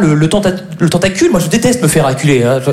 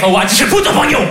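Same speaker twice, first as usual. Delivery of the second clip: fake angry Chinese; 6 semitones higher than usual. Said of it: "Le chinois énervé".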